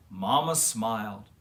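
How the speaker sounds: ordinary delivery, nothing marked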